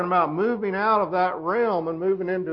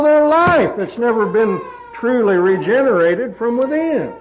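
forceful, raised-pitch preaching; khotbah berapi-api; 6 semitones higher, 6 dB louder than usual. Acted. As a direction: drive each point home like a preacher.

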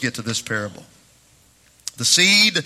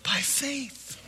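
higher, ringing preacher's delivery at full force; preaching; 9 semitones higher, 4 dB louder than usual. Acted. Pastor preaching faith to his congregation, full-force preaching.

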